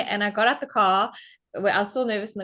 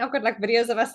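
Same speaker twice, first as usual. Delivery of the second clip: ordinary talk, nothing marked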